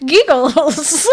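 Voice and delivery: giggling, high and loud. Real, not acted. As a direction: laugh it out mid-word. Laughing through sponsor read.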